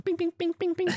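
high silly bing bing bing; playful high sound effect noises; mock bing sound effect. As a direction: chirp it like a cartoon sound effect. Imitating Juggernaut charging sound.

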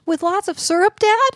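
put-on high, rising little-kid voice; squeaky hopeful child asking; eager kid. Son begging dad for syrup, high kid voice.

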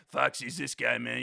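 lightly voiced chill guy; Foxy voice. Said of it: "I wanna introduce you to Foxy".

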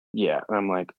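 ordinary delivery

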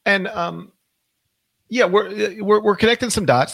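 usual speaking voice

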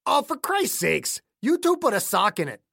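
put-on fed-up accented old-timer; exasperated old man. A narrator's reading. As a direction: scold the bickerers in a put-on regional accent. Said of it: "Clayton rolled his eyes".

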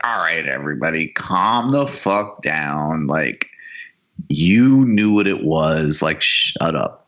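low, slow, weary brush-off; dismissive Saudi ruler. Retold or imitated. Saudis telling Americans to calm down, lightly voiced low brush-off. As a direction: wave away the critics like a bored autocrat.

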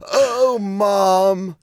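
high, drawn-out mock moan of "Oh, Mom". Host jokingly moans a name to his cohost, high mock moan. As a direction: moan the name like a joke in bed. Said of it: "say their name as you were moaning".

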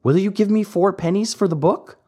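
high, quick and hopeful, lightly voiced; a little wooden boy blurting out his offer; pleading boy puppet. A narrator's reading. Pinocchio offers his book for four pennies, eager and quick. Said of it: "At last he said".

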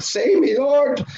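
desperate plea. Retold or imitated. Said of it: "I went".

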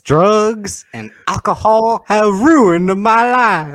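high, slow drawn-out character impression; Tyrone Biggums. Quoting a comic character to co-hosts, high drawling impression. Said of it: "Tyrone Biggums".

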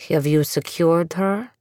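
slow, distant grandfather voice; unearthly voice. A narrator's reading. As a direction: speak like a ghost in the static. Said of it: "the disembodied voice".

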